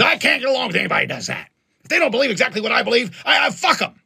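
high, fast dismissive rant; mock indignant buddy. Buddy refusing to get along with anybody, mock indignant. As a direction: imitate a friend angrily writing people off. Buddy's intolerant outburst retold.

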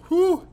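high pained blowing whew. Real, not acted. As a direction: blow out the burning heat. Blowing out chili heat.

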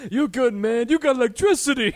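high, loud, odd-voiced Pete the electrician. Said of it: "when Pete the electrician goes".

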